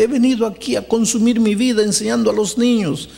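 high, anguished voice questioning God; distraught pleading. Retold or imitated. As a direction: plead as the heartbroken young woman, pitch held high. Acts distraught woman questioning the pastor.

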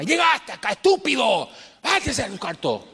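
high, harsh mock yell at a son; imitating angry father. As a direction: bark at the boy like an angry father. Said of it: "veía a mi papá gritando a mamá". (un padre gritando al hijo, imitado con enojo)

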